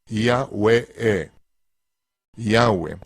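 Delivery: "Yahweh" sounded out syllable by syllable, ya-u-eh; pronunciación fonética de "Yahweh"; phonetic demo. Shown as a demonstration. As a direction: teach "Yahweh" slowly and carefully. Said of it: "Fonéticamente se pronunciaría correctamente".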